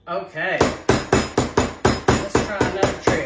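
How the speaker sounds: loud strained voice; pushing words out while twisting; opening a stuck jar. Real, not acted.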